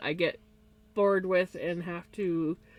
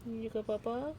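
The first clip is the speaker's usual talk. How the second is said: hushed, softer cheering on; soft pet encouragement; 13 dB quieter than usual. Real, not acted. Owner softly encouraging her pet, hushed. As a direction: egg the pet on gently.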